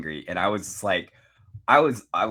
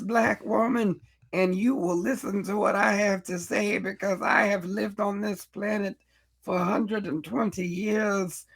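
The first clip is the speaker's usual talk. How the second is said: high, slow, grand elder proclaiming; wise old woman impression; 9 semitones higher than usual. Podcast host mocking her late roles, as a lofty high elder. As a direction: lecture them like an ancient sage. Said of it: "you were like".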